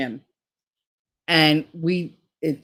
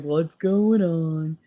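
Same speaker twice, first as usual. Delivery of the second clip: confused dog; much slower than usual. Retold or imitated. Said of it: "he's just bouncing around like".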